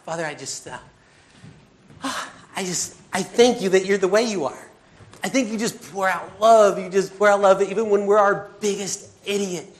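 earnest spoken prayer to God. Real, not acted. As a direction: pray aloud, sincere and pleading. Prayer closing the sermon.

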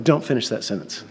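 low, soft cut-off; deadpan warning. Retold or imitated. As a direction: shut them down quietly. Cuts off friend's magician line.

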